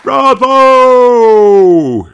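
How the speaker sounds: loud high cheer; cheering bravo. A narrator's reading. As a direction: shout it long. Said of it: "say all the parents".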